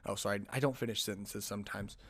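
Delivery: usual speaking voice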